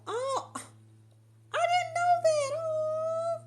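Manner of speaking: high, delighted gasp of surprise; sudden high surprised exclamation. Real, not acted. Reacting to surprising comment.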